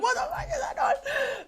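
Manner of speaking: high, laughing pet voice; startled cats' voice. Acted. Host voicing her cats freaking out at the noise, high and laughing. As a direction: squeak it out as alarmed cats, laughing. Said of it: "They're like".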